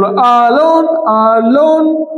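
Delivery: "alone" drawn out as two separate syllables, a - lone; split into syllables. Shown as a demonstration. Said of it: "syllable divide করবো". ("alone" দুই সিলেবলে ভেঙে, আ - লোন টেনে বলা)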